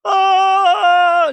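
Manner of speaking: a high sung "ah" shouted out; high operatic vocalizing. Retold or imitated. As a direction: belt a high diva note. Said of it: "she vocalizes".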